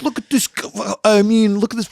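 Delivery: high-pitched Brooklyn street-performer voice; Brooklyn accent. Acted. Street performer catching himself before swearing, Brooklyn accent. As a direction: put on a high Brooklyn character voice. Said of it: "if I was doing that voice".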